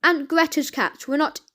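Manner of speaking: ordinary delivery, nothing marked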